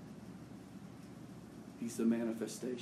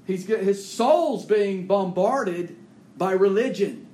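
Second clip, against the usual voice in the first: loud, high preaching at full force, slowing to stress; fiery preaching; 8 semitones higher, 11 dB louder than usual. Real, not acted. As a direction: thunder it from the pulpit.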